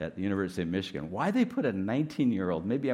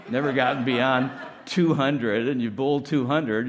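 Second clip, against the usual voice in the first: excited; 5 semitones higher, 8 dB louder than usual. Retold or imitated. Excited bowling example.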